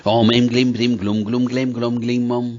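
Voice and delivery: fast monotone chant, syllables run together; sped-up mantra. Shown as a demonstration. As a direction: rattle off the seed mantra on one tone. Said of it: "थोड़ा speed में बोला". (तेज़ गति में मंत्र जाप)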